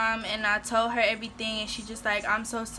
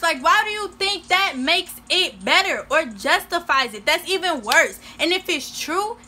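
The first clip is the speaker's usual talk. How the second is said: raised, higher-pitched outrage; real exasperated indignation; indignant rant; 4 semitones higher, 4 dB louder than usual. Real, not acted. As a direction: argue back loudly, punching the key words. Indignant reaction to his excuse.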